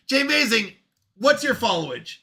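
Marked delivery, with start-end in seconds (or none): none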